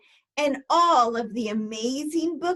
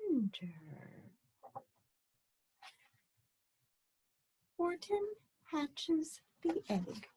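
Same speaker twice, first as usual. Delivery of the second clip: put-on soft, teasing read-aloud for kids; quiet story-time intro; hushed storytelling; 6 semitones higher, 10 dB quieter than usual. A narrator's reading. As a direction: draw the children in, softer and softer.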